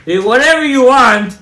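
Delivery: high, loud sweeping dismissal; emphatic dismissal. Real, not acted. Rabbi dismissing YouTube afterlife testimonies, emphatic.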